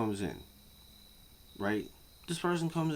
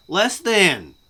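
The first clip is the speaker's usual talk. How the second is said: loud, higher, stress on than; loud exasperated echo; shouted repeat; 8 semitones higher, 12 dB louder than usual. Real, not acted. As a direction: bark the phrase back.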